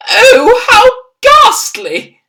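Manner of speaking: high, loud horrified cry, slow; an appalled Queen at the headline; cried out. A narrator's reading. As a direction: wail it in dismay. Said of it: "the famous voice cried out".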